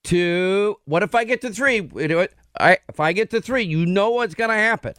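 mock parental warning, pitch climbing; imitating mom counting. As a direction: lightly mimic mom threatening to reach three. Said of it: "Exact cadence, by the way".

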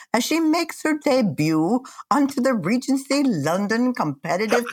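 hammed-up British accent, swooping pitch. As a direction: put on an exaggerated British narrator. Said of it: "Talk in a British accent".

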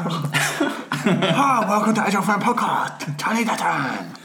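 high hammed-up Chinese accent; mock Chinese accent, sing-song announcement. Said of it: "[name] talking in, um, a Chinese accent".

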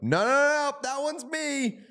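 high-pitched mock objection, stretched at the end; mock protest. Acting out a player claiming his goal, high mock protest.

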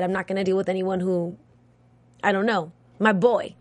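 ordinary delivery

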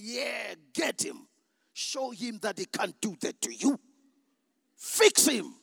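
building from an eager call to a full shout on fix him; flesh egging him on. Retold or imitated. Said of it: "my flesh is saying".

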